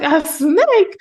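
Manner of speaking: grandma gasping at the snake news; shocked grandma. Retold or imitated. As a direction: imitate grandma's alarmed disbelief. Shocked grandma in retold call.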